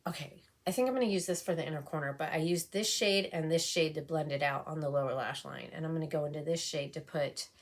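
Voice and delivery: ordinary delivery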